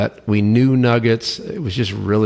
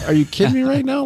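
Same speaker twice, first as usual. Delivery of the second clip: much higher, disbelieving voice; can't believe she'd even ask; incredulous; faster than usual. Retold or imitated. Repeating what he said to his wife, in high disbelief.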